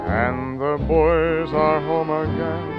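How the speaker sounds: ordinary delivery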